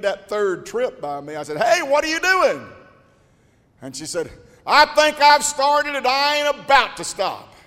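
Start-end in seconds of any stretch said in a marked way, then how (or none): 1.6-2.7 loud, high call across the house. Retold or imitated. Calling out to his wife. 4.7-7.5 wife's determined reply. Retold or imitated.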